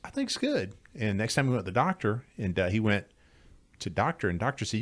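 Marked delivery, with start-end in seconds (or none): 0.0-0.7 much higher pitched happy guy; high-voiced friend. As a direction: squeak it out like the friend. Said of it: "He said".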